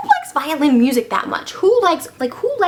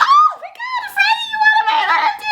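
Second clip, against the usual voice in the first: very high damsel-in-distress shriek; mock panicked scream; 3 dB louder than usual. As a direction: squeal in hammed-up terror. Elizabeth seized, cries for help.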